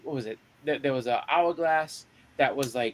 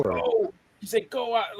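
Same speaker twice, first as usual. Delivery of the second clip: higher, yelling go; mock yell; 8 semitones higher, much slower than usual. Cyclops holding the door, yelling at his friends to run. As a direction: shout the order urgently.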